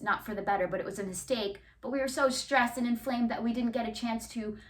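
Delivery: usual speaking voice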